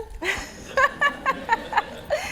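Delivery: a squeaky teasing laugh; high giggle. Real, not acted. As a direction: giggle at your own tease. Laughs after teasing question.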